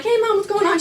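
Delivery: high, lightly voiced hello to mom; casual greeting.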